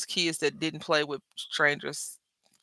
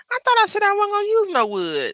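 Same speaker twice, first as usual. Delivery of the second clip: mock self-scolding; 5 dB louder than usual. Scolding herself over wrong beads.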